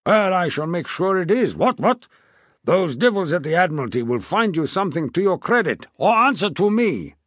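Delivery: put-on loud, high, bluff old king; a jovial, blustering royal voice. A narrator's reading. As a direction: boom it out like a hearty old monarch. King promises a ship.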